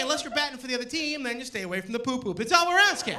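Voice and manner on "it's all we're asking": high, loud comic yell; shouted punchline. Real, not acted. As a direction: belt the tag line at the crowd. Rant punchline to crowd.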